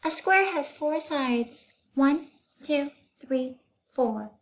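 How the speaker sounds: high, lilting schoolteacher; teacher voice. Acted. Teacher explaining shapes to her class, high teacher voice. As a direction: voice Miss Zarves teaching the class.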